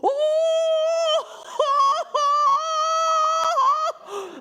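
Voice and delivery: loud, high ecstatic shriek of laughter. Shown as a demonstration. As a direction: laugh like you've seen heaven open. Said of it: "this is the rapture laugh".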